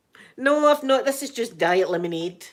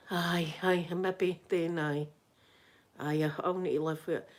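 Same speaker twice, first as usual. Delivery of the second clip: soft, lower-pitched giving in; resigned acceptance; 3 semitones lower, 6 dB quieter than usual. Retold or imitated. Answering the repairman about the aerial, quietly resigned.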